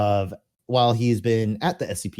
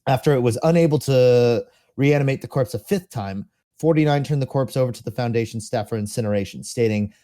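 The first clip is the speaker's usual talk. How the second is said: usual speaking voice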